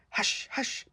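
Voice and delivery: whispered hush. Acted. Kittens warning each other of a rat, whispered. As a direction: whisper it so the rat can't hear.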